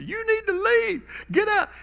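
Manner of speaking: high frantic shout. Retold or imitated. Mother screaming at her son to leave, frantic high voice. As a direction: scream him out of the house.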